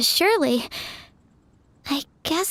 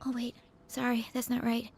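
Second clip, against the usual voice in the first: soft, lower self-correction aside; embarrassed mutter; 4 semitones lower, 12 dB quieter than usual. Acted. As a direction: catch yourself under your breath. Singer stops after mistake.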